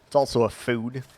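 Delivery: ordinary talk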